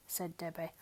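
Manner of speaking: usual speaking voice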